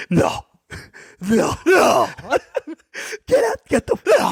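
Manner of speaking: very high squealed refusal, half laughing; mock shocked disbelief. Imitating his friend spotting him round the corner, hammed-up shock.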